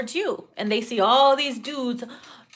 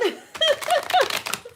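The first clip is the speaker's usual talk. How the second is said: high squealing laugh. Real, not acted. Cracking up.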